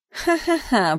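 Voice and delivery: flat sarcastic ha-ha-ha; mock laugh. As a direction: sneer out a fake laugh. Mocking fake laugh at wall.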